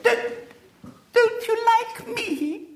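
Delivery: put-on high, slow, trembling girl's voice; a small girl on the verge of tears; quavering little girl. A narrator's reading. Little girl asks William if he likes her, quavering. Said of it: "quavered Violet Elizabeth".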